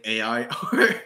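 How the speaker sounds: ordinary talk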